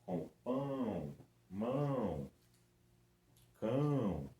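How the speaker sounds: "pão, mão, cão" with strong nasal ão; nasal vowels. Shown as a demonstration. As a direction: push the ão through the nose. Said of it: "Viram que eles são mais nasais?". ("pão, mão, cão" bem nasalizados)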